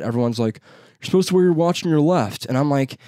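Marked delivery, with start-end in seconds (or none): 1.0-2.5 higher-pitched imitation of naggers; mock scolding. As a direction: imitate people giving you heat. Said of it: "Everyone gives me heat about it".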